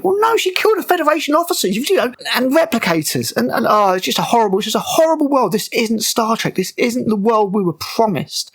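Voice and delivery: high-pitched, exasperated fan outrage; indignant rant. Real, not acted. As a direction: protest in rising exasperation, pitched well up. Ranting against the show's dark world.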